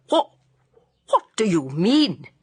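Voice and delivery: mild stammer of alarm; startled stammer. A narrator's reading. Startled lodger questions landlady, stammering slightly. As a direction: break off, then restart the question. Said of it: "he stammered".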